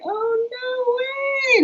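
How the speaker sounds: high, slow, crying "no way"; tearful high disbelief; stunned disbelief. Retold or imitated. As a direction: let it crack into tears of shock. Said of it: "I was like".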